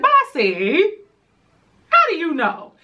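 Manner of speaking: high-pitched mock questioning mom; imitated mama. Acting mama's startled reply to her.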